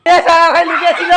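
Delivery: loud shout through laughter; laughing shout. Real, not acted. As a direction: shout it while laughing hard. Seeker finds hidden kid.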